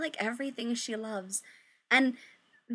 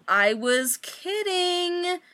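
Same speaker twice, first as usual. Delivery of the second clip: drawn-out I was kidding; 8 semitones higher, 4 dB louder, much slower than usual. A narrator's reading. Victoria's quote in story.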